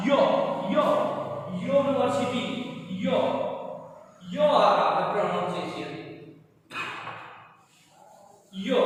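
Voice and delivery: यो sound of यूनिवर्सिटी isolated and repeated; pronunciation demo. Shown as a demonstration. Teacher isolates the yo sound for students. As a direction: stress the yo at the start of यूनिवर्सिटी. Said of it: "तो क्या आ रहा है".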